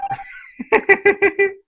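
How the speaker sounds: sudden high laughing burst; high-pitched giggle at the game; burst of laughter. Real, not acted. Reacting to the game.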